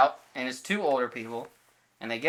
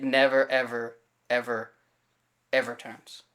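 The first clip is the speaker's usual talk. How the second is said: drawn out slowly; 2 semitones lower, much slower than usual. Real, not acted. Car never reappears, drawn out.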